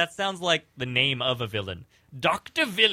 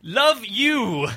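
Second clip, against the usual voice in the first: mock villain catchphrase; 9 semitones higher, 4 dB louder than usual.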